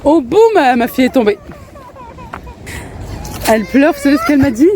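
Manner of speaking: ordinary talk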